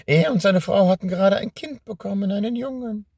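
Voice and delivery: high, gloating witch voice. Acted. Witch answers mockingly. (hohe, überhebliche Hexenstimme)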